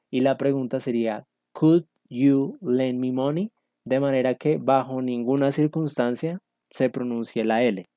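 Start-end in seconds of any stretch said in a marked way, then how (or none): 1.5-3.5 slow question, "Could" with silent L; careful, spaced-out "Could", no L sounded; model pronunciation. Shown as a demonstration. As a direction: spell it out word by word, "Could" like "cood". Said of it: "para que ustedes capten la pronunciación".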